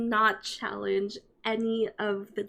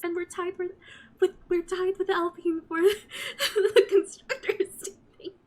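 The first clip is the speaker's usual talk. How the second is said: higher, choked half-laugh, half-cry; laughing through tears; 7 semitones higher than usual. Real, not acted. Laughing and crying over her first points, tied with Alpine. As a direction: overcome with joy, laughing and crying at once.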